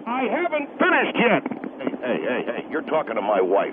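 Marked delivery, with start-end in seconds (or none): 1.8-3.7 low, gruff angry husband. Acted. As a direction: growl it like a protective husband. Husband cuts in defending wife.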